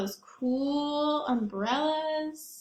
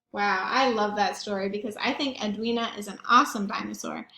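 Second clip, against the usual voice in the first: usual speaking voice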